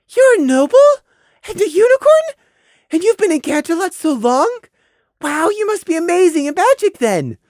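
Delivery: very high, loud, awed little voice; childlike wonderment. Acted. Prey feigning awe at the noble mare, childlike wonderment. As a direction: marvel like an amazed kid. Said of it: "Prey said in wonderment".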